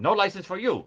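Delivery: high, loud mock-tyrant shout; Soup Nazi. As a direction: refuse it in a shouted parody. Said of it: "like the soup Nazi".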